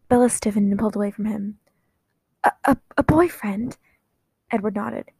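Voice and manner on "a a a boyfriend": stunned stammering whisper; shocked whisper. Acted. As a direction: breathe it out in disbelief. Bella shocked at boyfriend news.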